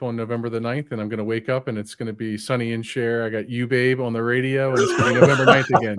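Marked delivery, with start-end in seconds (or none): 4.7-6.0 laughing through the words; chuckling while talking. Real, not acted. Joking about repeating November ninth.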